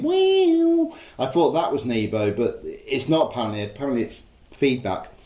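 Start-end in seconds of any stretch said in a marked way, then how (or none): 0.0-0.9 high sliding wee-oo; imitated guitar wail. Host imitates the guitar intro of Heroes, high swooping wail. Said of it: "you know that bit at the beginning".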